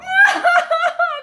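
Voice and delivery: very loud, high mock wail; little girl crying. A narrator's reading. Little girl cries in the squeaky bed, loud mock wail. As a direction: burst into a pulsing storybook bawl. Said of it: "cried the little girl".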